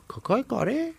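squeaky puzzled repeat; puzzled again. Acted. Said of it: "با خودش تکرار کرد".

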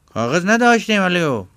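high, loud, comic sidekick voice; a high, brash southern lackey; squeaky loud henchman reporting back; Ayyub's high voice. Acted. Ayyub returns, character voice.

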